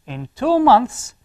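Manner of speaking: In two months, slow, high and loud with weight on two; emphatic in two months. Shown as a demonstration. Teacher highlighting the time phrase for students. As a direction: lean hard on two, slowly. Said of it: "teacher stressing the time phrase".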